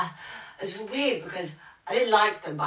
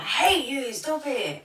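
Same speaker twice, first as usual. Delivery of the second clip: high, playful mock protest; jokingly whiny, pitched well up; jokey; 9 semitones higher, 4 dB louder, slower than usual. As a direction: tease a friend with pretend dislike. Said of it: "in, like, a jokey".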